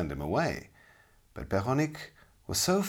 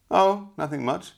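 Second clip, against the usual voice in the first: boastful; 11 semitones higher, 7 dB louder than usual. Acted. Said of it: "replied the knight boastfully".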